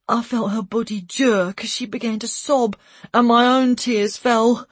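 usual speaking voice

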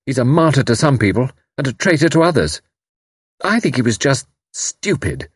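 high, bitter, rebellious telling; pent-up rebellion. Acted. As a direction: let long-held resentment spill out. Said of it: "the note of pent-up rebellion".

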